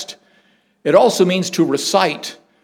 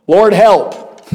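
higher, louder, blurted panic; panicked prayer; 5 semitones higher, 6 dB louder than usual. Retold or imitated. Dad in a brakeless bus full of kids, panicked cry to God. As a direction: yelp it as the brakes fail. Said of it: "the prayer was very theological".

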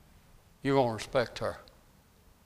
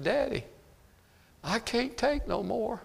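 a hint of a pleading little boy, soft and high; child's voice; 9 semitones higher, 6 dB quieter, slower than usual. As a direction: voice the son softly, higher, slower. Boy pleads to his father.

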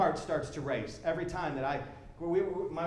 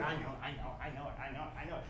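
much lower and softer, dismissive mumble; bored brush-off; 6 semitones lower, 12 dB quieter, faster than usual. Retold or imitated. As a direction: wave it away, you've heard it all. Jaded believer brushes off Scripture.

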